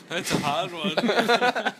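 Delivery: very slow, high and loud musing; slow pondering. Retold or imitated. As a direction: drag it out as if thinking hard. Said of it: "I was like".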